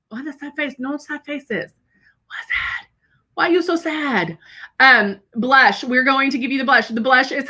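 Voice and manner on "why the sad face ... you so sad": soft coo. Real, not acted. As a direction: coo softly to a sad friend. Cooing to sad viewer.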